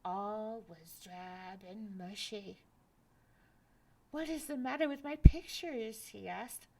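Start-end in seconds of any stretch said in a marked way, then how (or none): 4.1-6.1 1 dismayed cry. Acted. Jack dismayed at drab world.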